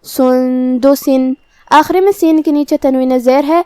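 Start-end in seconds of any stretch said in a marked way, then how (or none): 0.0-1.3 سندسن very slow, a nasal hum on the hidden نون; سندسن slowly with the نون hidden in ghunna; ikhfa with ghunna. Shown as a demonstration. Teacher demonstrates ikhfa on one word, slow and nasal. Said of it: "یہ حکم کو ہم غنہ کے ساتھ ادا کریں گے".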